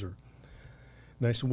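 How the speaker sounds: usual speaking voice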